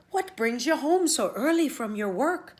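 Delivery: put-on Una in Irish accent. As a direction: lift the voice for Una, Irish lilt. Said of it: "said Una".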